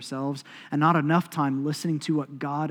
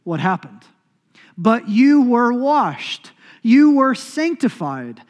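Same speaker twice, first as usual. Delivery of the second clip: slow, louder, lifted reading of Paul; slow, solemn, raised scripture recital; 3 semitones higher, 7 dB louder, much slower than usual. Acted. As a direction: declaim the verse slowly, each phrase weighed. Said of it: "He says".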